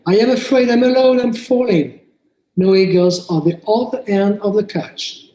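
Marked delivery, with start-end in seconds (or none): 0.1-2.0 higher, mock-scared plea; lightly played scared little figure; pretend fear. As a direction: voice a frightened toy figure alone at the bottom. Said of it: "I say".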